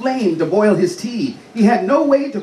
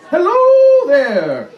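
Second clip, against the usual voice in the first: high, slow and loud squeaky voice; 9 semitones higher, 6 dB louder, much slower than usual. Acted. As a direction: squeak it out slowly. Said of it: "in a rather squeaky voice".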